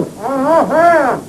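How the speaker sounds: muffled wordless grunts; mouth full. Acted. Asked if he's all right, he replies in mouth-full mumbles. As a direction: try to answer with the mouth stuffed. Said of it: "Don't talk with your mouth full".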